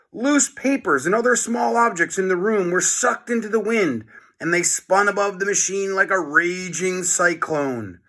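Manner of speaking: lively storybook read-aloud voice; reading to children. A narrator's reading. Teacher reads chapter aloud to class.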